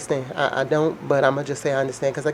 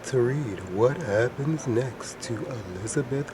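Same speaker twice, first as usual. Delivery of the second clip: lower, slow, forced-deep reading voice; Elizabeth Holmes deep voice; 2 semitones lower than usual. Pretend. Vlogger reads Holmes news story in her forced-deep Holmes voice. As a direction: press the voice down as deep as it goes and read slowly. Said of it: "impersonation of Miss Holmes".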